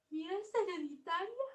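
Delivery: high, rising frightened question; fearful question. Shown as a demonstration. Teacher voicing Mafalda's frightened question. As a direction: ask it scared, voice climbing. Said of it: "Con miedo".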